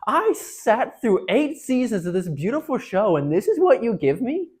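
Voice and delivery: loud, high, drawn-out outrage; slow, stretched, exasperated yelling; yelled complaint. Retold or imitated. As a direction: rail at the screen like a betrayed fan. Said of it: "I, like, yelled".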